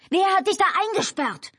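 very high, strained shout; high frantic yell. A narrator's reading. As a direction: shout it in alarm, pitch way up. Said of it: "schrie Albert".

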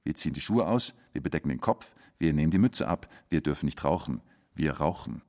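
quiet list. Acted. Quiet listing of rules.

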